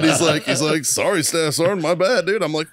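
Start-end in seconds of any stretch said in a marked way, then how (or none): 0.9-2.4 high-pitched sheepish retold apology; mock apology. Marine to his staff sergeant after being told off, mock apologetic. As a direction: imitate a junior Marine saying sorry.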